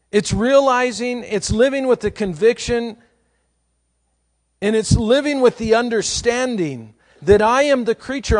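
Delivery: high, loud, fervent sermon climax; full-force preaching. Acted. Pastor pleads with his congregation to see humility, high and loud. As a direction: preach it out with rising conviction.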